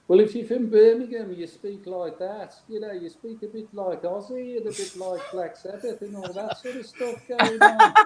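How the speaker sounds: high-pitched put-on Birmingham accent. Shown as a demonstration. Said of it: "What would a proper Birmingham accent sound like?".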